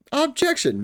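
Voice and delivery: high, overeager courtroom cry; excited objection. Acted. Defense lawyer objecting before anything happened, excited. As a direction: burst out high, too soon. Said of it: "I got excited".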